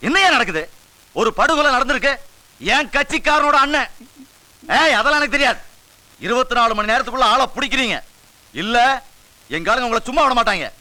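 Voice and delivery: high-pitched, hectoring phone tirade; angry demand. Acted. Boss berates police inspector over a murder, angry.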